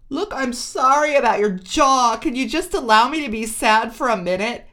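high, tearful plea through a door; wounded voice through the bathroom door. A narrator's reading. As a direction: beg to be left alone, near tears. Crying girlfriend behind bathroom door.

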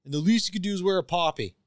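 Don Cherry rant. Retold or imitated. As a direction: belt it like a blustering commentator. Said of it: "Don Cherry at all".

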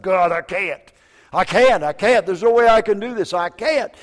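retold desperate prayer, high and loud; desperate plea. Grieving father kneels by the bed telling God he can't, desperate.

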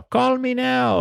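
high mock call me now; mock psychic catchphrase; quoting the catchphrase. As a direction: chirp the title like the ad. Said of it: "suddenly I saw".

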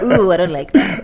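loud, slow, laughing impression of him; teasing the husband who hates correction; mock husband. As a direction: ham up his squeamish complaint. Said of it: "He's like".

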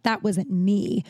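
slow, drawn-out mock denial; pointed denial. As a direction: imitate a heavy, deliberate denial. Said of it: "I wasn't like".

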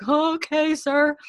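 high, tight, drawn-out nervous okay; terrified compliance. Retold or imitated. Frightened man answering a superior, mock terrified squeak. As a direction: squeak it out high, as if scared stiff. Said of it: "crapping his pants".

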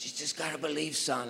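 low, soft voice of a dying father; a faint, failed attempt at a Scottish accent; Sean Connery. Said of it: "I can't do the Scottish accent".

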